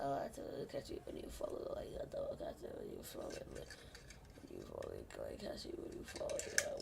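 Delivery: whispered, quick and breathy; fast whispered aside. Real, not acted. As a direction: mutter it under your breath. Whispered after song.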